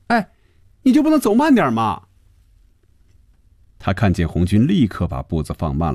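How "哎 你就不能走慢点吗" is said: high-pitched complaining call. A narrator's reading. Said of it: "先喊了一句".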